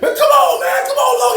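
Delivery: high shouted plea; imitated shout. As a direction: yell it high and desperate. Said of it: "he was like".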